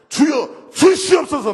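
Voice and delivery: loud, high demanding cry; shouted prayer. Retold or imitated. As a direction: shout the plea like a fervent prayer. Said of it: "막 그러더라고". (크게 외치는 기도 흉내)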